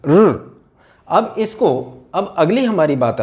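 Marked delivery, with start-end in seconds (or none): 0.1-0.6 र said softly and alone; soft r demo. Shown as a demonstration. Teacher demonstrating the soft consonant र. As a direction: tap out a gentle र. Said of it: "कोमल प्रकार की है".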